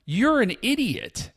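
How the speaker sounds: high, slow jeering put-down; mocking taunt. Venezuelans jeering at New York protesters, mocking. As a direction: rub it in their faces.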